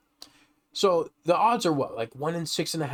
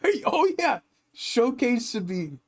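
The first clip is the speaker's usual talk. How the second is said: retold amazement; 8 semitones higher, slower than usual. Re-enacting reaction to the showcase pull.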